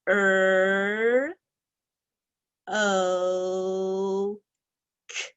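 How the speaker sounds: sounds spaced out one by one; slow sounding out. Shown as a demonstration. Teacher saying each sound slowly while students write. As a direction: hold each sound apart. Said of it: "say each sound slowly".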